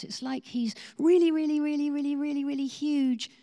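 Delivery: high, loud, rising awe; building excitement. Retold or imitated. Mark tells Liz how huge God is, excited. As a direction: stretch each really bigger and bigger. Mark describes God's hugeness.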